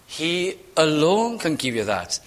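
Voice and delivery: normal talking voice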